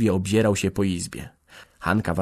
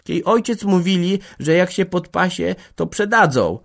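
put-on high, loud girl's voice; high-voiced girl chiming in; young household girl; 10 semitones higher, 6 dB louder than usual. A narrator's reading. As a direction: pipe up with an objection, pitched well up.

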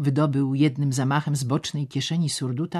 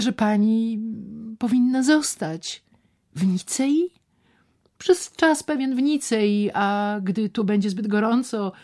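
higher and louder, coaxing; voiced persuasive man; 8 semitones higher, 5 dB louder than usual. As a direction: talk her into staying in Nice. Szczerbic advising her.

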